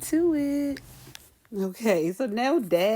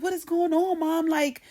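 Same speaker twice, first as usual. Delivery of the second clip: high-pitched acted bewilderment; alarmed questioning; 9 semitones higher than usual. Kids catching Mom with a body, alarmed questioning. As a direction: demand an answer, voice raised in pitch.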